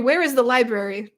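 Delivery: normal talking voice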